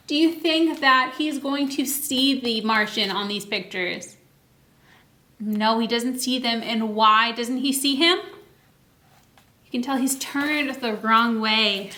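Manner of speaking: plain reading voice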